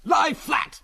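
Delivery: high-pitched urgent shout; warning yell. A narrator's reading. Biggles warning the others as the plane dives, urgent yell. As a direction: yell a sudden warning, voice leaping up. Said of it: "let out a warning yell".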